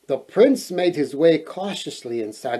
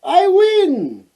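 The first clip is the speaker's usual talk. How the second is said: high triumphant shout; queen's shout; 6 dB louder than usual. A narrator's reading. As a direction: yell the win out, much higher and louder. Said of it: "the queen shouted".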